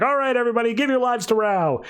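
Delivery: high-pitched, urgent rallying call; freaking out. Retold or imitated. Prophets urging their followers on, frantic. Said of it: "the prophets start freaking out".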